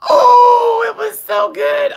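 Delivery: very high, loud shriek of glee; real delighted squeal. Real, not acted. Storyteller reliving her favourite car prank, shrieking with delight. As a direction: squeal it out, overcome with hilarity. Overcome recalling the hilarious prank.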